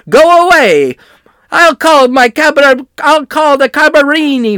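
loud put-on Italian shouting; angry Italian man. A dentist yelling at reporters, loud Italian voice. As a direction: shout him off like a cornered Italian. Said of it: "This is what he said".

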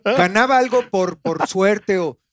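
usual speaking voice

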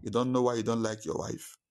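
ordinary talk, nothing marked

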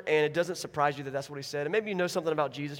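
usual speaking voice

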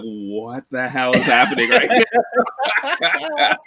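drawn-out, laughing disbelief; baffled outburst through laughter; retold disbelief. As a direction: relive the shock, half laughing. Retelling his shocked reaction to noise.

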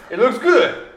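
high, loud laughing. Real, not acted. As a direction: laugh out the words. Laughs at the monkey joke.

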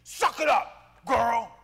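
loud, slow "suck it up"; tough shout. Retold or imitated. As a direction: bark it at yourself. Retold tough self-talk, shouted.

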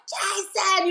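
high mock scream of his name; screaming Jason. Wife calling her husband in pain, high mock scream. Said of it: "you know, screaming".

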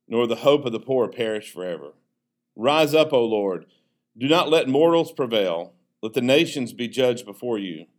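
ordinary talk, nothing marked

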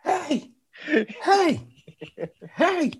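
very high falsetto calls; spaced barks spoken as words; barking dog voice. Retold or imitated. Dog barking at something in the yard, high imitation.

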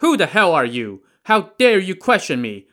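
high, loud indignant outburst; angry defiance. Acted. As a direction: hurl it at the judge. Prisoner defies the prefect.

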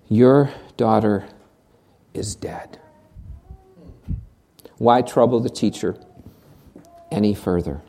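soft, low, grave delivery; hushed news of death. Acted. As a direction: break the news gently and quietly. Messengers bring news of death.

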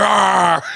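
drawn-out mock monster roar; imitated roar. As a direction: roar like the kid locked next door. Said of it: "Like that and loud screams".

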